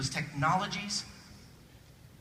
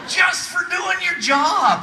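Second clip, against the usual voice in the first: slow, high, loud celebratory yell; triumphant shout; 10 semitones higher, 8 dB louder, much slower than usual. Acted. Magician celebrating an ordinary job, drawn-out triumphant shout. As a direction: bellow it like a stage finale.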